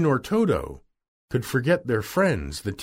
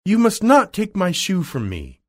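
put-on high-pitched girlish protest; indignant cry; 7 semitones higher, 4 dB louder than usual. A narrator's reading. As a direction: protest crossly in a raised pitch. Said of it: "cried Dorothy".

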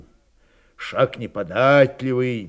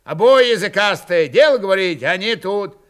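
raised, higher scolding; angry rebuke; 6 semitones higher, 6 dB louder than usual. A narrator's reading. Officer rebukes two loud-tongued squabblers, angry. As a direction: tell them both off forcefully.

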